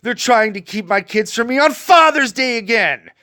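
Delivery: real anger; angry. Real, not acted. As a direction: vent real fury. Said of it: "it's because I am".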